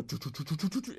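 rapid sputtering engine imitation; pod racer engine noise. Kid in mother's car on a turn, pod racer engine noise. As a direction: rattle off engine putters like a kid. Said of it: "I used to do the, uh, the pod racing, uh, engines".

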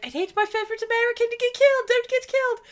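prim mother fussing over her favourite; a high-pitched motherly warning, played for laughs; mock Shaw's mom. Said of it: "Shaw's mom drops him off and it was like".

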